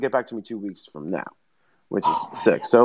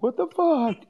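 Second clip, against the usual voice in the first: much higher, panicked startle; startled shout; 14 semitones higher, slower than usual. Retold or imitated. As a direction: jolt awake in shock. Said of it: "you'd be like".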